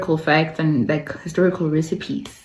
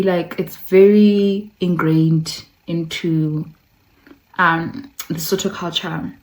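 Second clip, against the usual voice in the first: ordinary talk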